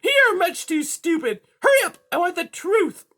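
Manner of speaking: high, loud interrogating cat; high-pitched bullying villain; menacing cat. A narrator's reading. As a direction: demand an answer in a piercing high voice. Cat demands the truth.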